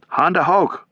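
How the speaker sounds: high, sharp German command. A narrator's reading. Sergeant commanding German gunners to surrender. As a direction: bark the surrender order. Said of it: "DeLizio commanded".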